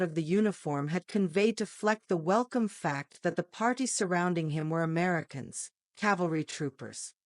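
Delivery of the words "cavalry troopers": usual speaking voice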